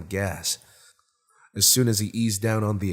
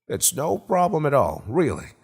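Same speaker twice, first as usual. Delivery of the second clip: higher, lightly hearty host voice; Gerald Jones, obliging; 6 semitones higher, 4 dB louder than usual. Acted. Homeowner assures Tracy it is no trouble, lightly hearty. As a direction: brush off the thanks with easy fifties swagger. Same host reassuring his guest.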